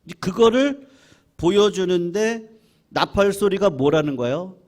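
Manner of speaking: ordinary talk, nothing marked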